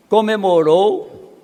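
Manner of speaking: ordinary talk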